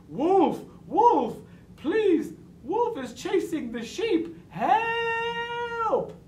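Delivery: high, urgent shouts for help; loud desperate cry. A narrator's reading. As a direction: cry out in alarm, ending on a long wail. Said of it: "cried loudly".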